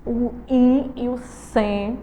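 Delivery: "in" and "sen" said slowly, each nasal; slow syllable-by-syllable nasal demo; syllables pulled apart. Shown as a demonstration. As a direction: stretch out "o in e o sen". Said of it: "quando a gente fala a palavrinha incên".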